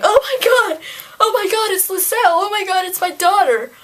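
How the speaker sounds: high, loud, panicky fake wail; mock crying hysterics. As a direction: squeal it in exaggerated distress. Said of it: "Arya didn't start crying".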